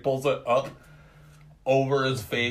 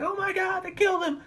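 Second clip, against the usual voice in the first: high shrill panicked impression; high mock panic. Friend acting out the students, high mock panic. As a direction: screech it like scared college kids. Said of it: "they're like".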